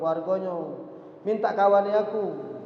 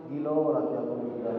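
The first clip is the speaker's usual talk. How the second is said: wife's inner thought; 6 semitones lower, faster than usual. Retold or imitated. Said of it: "mengkali dalam hatinya".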